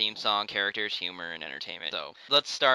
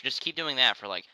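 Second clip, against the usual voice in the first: normal talking voice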